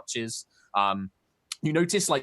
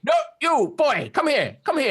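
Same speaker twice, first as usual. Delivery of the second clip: put-on high, curious old turtle creature voice; a wary hermit peering at strangers; old turtle man; 13 semitones higher, 4 dB louder than usual. GM voicing the old turtle hermit to the party.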